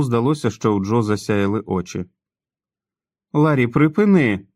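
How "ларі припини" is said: high, loud sharp rebuke; snapping angrily. A narrator's reading. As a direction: cut him off hard. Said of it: "гримнула Надін". (сердито гримнула)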